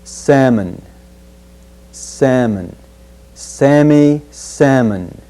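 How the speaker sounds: "salmon" as sammon, with no L; silent L. Shown as a demonstration. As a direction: drop the L in "salmon". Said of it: "we will omit the L sound".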